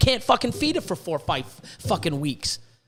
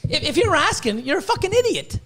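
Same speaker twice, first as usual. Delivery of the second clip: much higher, exasperated scold; 8 semitones higher than usual. Real, not acted. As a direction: snap it at foolish buyers. Scolding buyers.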